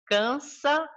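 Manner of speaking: slow "cansa a nossa vista", with the two As joined; syllable scanning. Shown as a demonstration. Teacher scanning a verse for students, slow and measured. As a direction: scan "cansa a nossa vista" deliberately, not separating the As. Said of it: "esses dois Azinhos eu não separo".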